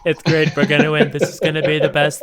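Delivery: loud, laughing Trump impression. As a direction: belt it like a rally boast. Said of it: "It's 'cause it's not hard".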